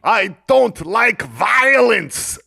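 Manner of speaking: high, drawn-out shouted war cry; battle cry. Acted. Player screaming a battle cry as his sword fighter attacks, shouted. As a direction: cry it out slowly and loud, pitched well up. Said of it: "scream my battle cry".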